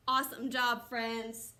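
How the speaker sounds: normal talking voice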